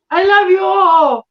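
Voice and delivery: high, slow, sugary voice; [name] impression. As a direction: coo it sweetly like a kids' host. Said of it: "He'll be like".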